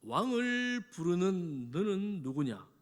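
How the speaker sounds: slow, drawn-out haughty demand; self-important, drawling challenge; haughty commander's challenge. Acted. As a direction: answer like someone acting as king, stretching the words. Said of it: "아브넬이 말합니다".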